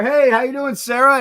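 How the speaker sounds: high, loud friendly hello; light up as you greet the clerk by name; cheerful name greeting. Shown as a demonstration.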